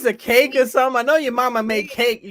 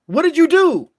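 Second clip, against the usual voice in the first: mock referee yelling at the heel; a ref demanding answers, higher and louder; imitating the ref; 8 semitones higher, 6 dB louder than usual. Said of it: "ref's like".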